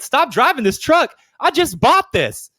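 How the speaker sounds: a lightly voiced offended guy; indignant retort. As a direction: play the proud truck owner, outraged. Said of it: "And he went".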